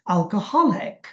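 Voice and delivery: drawn HOL in English "alcoholic"; stress on HOL. Shown as a demonstration. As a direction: lean on the হ of "alcoholic". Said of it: "হতে জোর দিচ্ছি".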